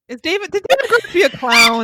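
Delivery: high, loud, fast burst through laughter; laughing question. Real, not acted. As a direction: blurt it out while cracking up. Cracking up over a clown joke.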